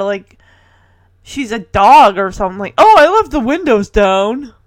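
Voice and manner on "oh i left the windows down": louder, higher mock excuse; hammed-up careless husband; mocking imitation. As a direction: parrot the excuse with scorn.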